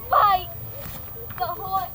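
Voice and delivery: hammed-up Australian shout; shouted Australian "mate". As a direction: yell "Mate" like an Aussie bloke. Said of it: "He has this Australian voice".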